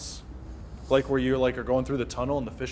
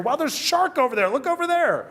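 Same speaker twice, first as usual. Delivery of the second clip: mock excited distraction; 10 semitones higher than usual. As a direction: fake wow to distract kids. Parent distracting kids at aquarium.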